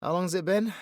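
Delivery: usual speaking voice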